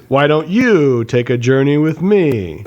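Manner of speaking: very slow, overdrawn sarcastic read; mocking bad line reading. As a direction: stretch it out to mock the director. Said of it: "exactly like the other guy's shitty read".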